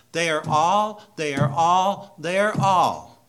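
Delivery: fiery preacher's refrain; preaching at full force. Acted. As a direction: pound the repetition from the pulpit. Repeated preaching climax.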